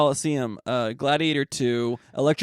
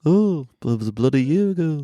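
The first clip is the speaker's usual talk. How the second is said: mock English accent, lightly done; 3 semitones higher than usual. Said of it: "that sounds English, not Australian".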